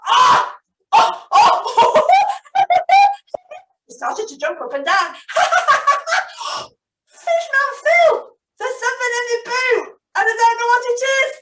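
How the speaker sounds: high, loud excited puppet squeals. Acted. Marvin yelps to Fishman Phil about his boot, high and excited. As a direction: jump about with startled glee.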